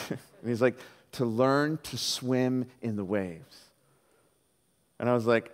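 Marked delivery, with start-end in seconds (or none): 1.1-3.6 slow, deliberate word-by-word reveal; portentous dad. Retold or imitated. Retelling dad announcing the swim lesson, slow and portentous. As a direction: draw it out like a grand announcement.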